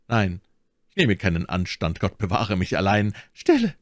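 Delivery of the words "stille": sudden high, sharp hush; interrupting. A narrator's reading. Milady hears someone coming, cutting him off. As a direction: cut him off abruptly. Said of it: "unterbrach sie ihn".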